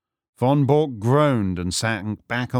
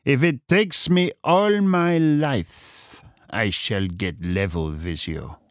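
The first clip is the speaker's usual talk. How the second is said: slow deliberation; slower than usual. A narrator's reading. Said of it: "speaking with slow deliberation".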